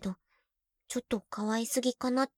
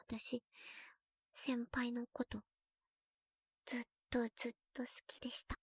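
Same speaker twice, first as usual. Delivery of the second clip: soft, fast breathy confession; hushed love confession; whispered confession; 11 dB quieter than usual. Acted.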